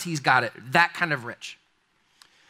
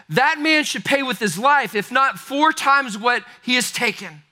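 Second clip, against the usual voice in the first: higher, louder, indignant outrage; furious; 7 semitones higher, 4 dB louder than usual. Retold or imitated. David condemning the rich man to Nathan, furious outrage. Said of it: "he was furious".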